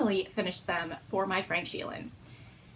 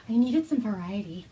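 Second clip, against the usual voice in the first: mock sultry confession; sultry naughty voice. Knitter teasing listeners about eleven projects, mock sultry. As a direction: purr it like a naughty secret. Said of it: "talk like this".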